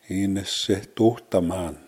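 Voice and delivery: slow "In se tohtaman", every vowel sounded; "In se tohtaman" slow and uncontracted; full form. Shown as a demonstration. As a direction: spell out "In se tohtaman" slowly by syllable. Short I's are often deleted.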